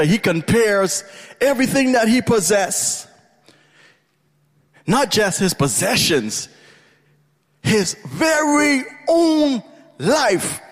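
high, sustained preacher's cry; impassioned pulpit fervour, pitched far up; full-force preaching. Real, not acted. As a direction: build to a soaring, shouted declaration.

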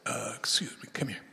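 hushed, half-whispered summons; soft confidential murmur; discreet aside. Acted. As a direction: quietly beckon someone away from the group. Peter discreetly calls Jesus aside.

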